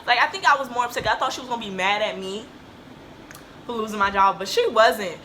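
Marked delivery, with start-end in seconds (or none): none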